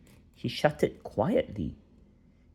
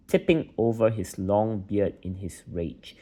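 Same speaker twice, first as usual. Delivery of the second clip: plain reading voice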